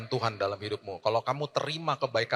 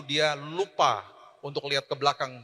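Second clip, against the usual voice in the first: usual speaking voice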